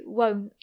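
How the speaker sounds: won't said without its final T; dropped T. Shown as a demonstration. Teacher showing learners her everyday won't. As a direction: leave the T off won't. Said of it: "I don't usually pronounce the T".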